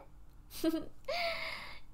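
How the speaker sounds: high, soft trailing giggle; pleased villain's titter. Acted. Vampire amused by own epiphany.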